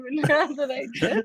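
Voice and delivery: ordinary talk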